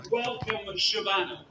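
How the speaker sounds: ordinary delivery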